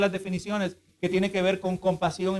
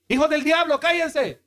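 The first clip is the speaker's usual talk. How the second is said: sharp, raised scolding of the crowd; mock harsh rebuke; 6 semitones higher than usual. Preacher mocking a harsh rebuke to the crowd, raised and sharp. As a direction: snap at them like a harsh preacher. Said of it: "él pudo haber dicho".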